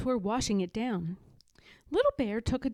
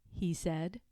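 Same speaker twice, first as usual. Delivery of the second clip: ordinary delivery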